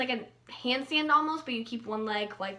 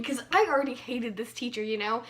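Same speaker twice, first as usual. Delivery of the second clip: ordinary talk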